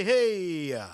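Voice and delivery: slow, sung-out radio host voice; booming radio voice. Acted. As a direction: boom it like an old radio DJ. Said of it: "Big booming radio voice there".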